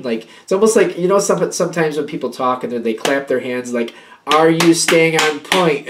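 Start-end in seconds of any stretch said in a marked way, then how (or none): 4.3-5.9 slow, louder, clapping on each word; hammed-up stern lecturing, drawn out; overdramatic lecturer. Talker who claps hands, overdramatically drilling the listener. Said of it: "it's a bit overdramatic".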